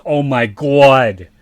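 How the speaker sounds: slow, loud, drawn-out Boston "Oh my God"; Boston accent. Retold or imitated. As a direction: stretch it out like a Boston guy. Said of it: "because he goes".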